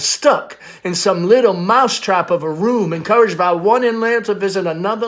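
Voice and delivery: a worried mother pressing her daughter about the future; Amanda, pleading mother. Acted. Mother confronting daughter about her future, pleading.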